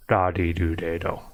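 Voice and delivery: da di do de do with a soft tapped D; soft D syllables. Shown as a demonstration. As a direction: tap each syllable lightly like a soft D. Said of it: "try to make the sounds".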